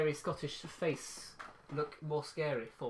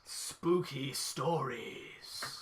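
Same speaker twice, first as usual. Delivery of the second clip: spooky announcer voice; 4 semitones lower, 4 dB quieter, much slower than usual. Acted. Announcing spooky story segment.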